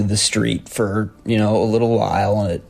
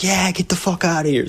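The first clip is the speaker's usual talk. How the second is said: higher, pumped-up echo of his friend; piping up; 9 semitones higher than usual. Retold or imitated. Narrator piping up at the intruder, emboldened and high. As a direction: jump in, fired up by the roommate. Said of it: "I kinda piped up".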